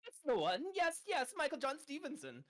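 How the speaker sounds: high, delighted chirp; eager character voice. Acted. Hubert eagerly confirming Michael to the ox, high and delighted. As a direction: jump on the name with delight.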